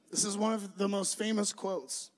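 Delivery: usual speaking voice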